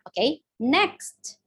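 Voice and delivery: normal talking voice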